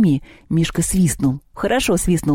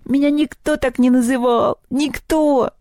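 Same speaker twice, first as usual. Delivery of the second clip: high-pitched weepy goblin voice; hammed-up crying complaint; tearful lament; 9 semitones higher than usual. A narrator's reading. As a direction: wail pitifully, feeling unloved. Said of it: "заплакал кабинетный".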